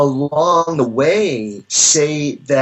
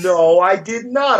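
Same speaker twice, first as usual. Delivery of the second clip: normal talking voice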